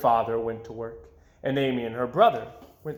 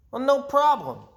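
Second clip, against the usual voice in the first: cocky brother; 12 semitones higher, 4 dB louder than usual. A narrator's reading. Brother boasts.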